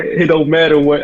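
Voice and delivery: high, loud catchphrase; The Rock. Retold or imitated. Friend echoing the wrestler's borrowed catchphrase, high and loud imitation. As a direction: cut someone off like a wrestling showman. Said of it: "doing the Rock's catchphrases".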